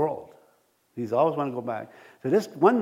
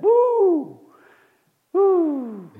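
much higher, louder woo-hoo; excited cheer; 7 dB louder than usual. Retold or imitated. Pastor cheering as they reach Superstore, excited. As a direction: whoop with joy at the store. Whooping inside store.

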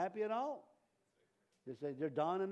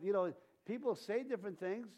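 ordinary talk

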